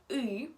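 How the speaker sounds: Y said as the tense Russian ы; Russian ы sound. Shown as a demonstration. Teacher introducing the difficult Russian letter, Russian ы sound. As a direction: say Y as a back-of-mouth ы. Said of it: "es muy difícil de explicar".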